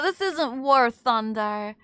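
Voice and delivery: usual speaking voice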